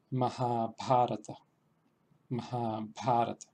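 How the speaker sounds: "Mahabharata" slow, syllable by syllable; slow sanskrit word. Shown as a demonstration. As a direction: spell out "Mahabharata" slowly in Sanskrit. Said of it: "las voy a repetir dos veces". ("Mahabharata" despacio, sílaba a sílaba)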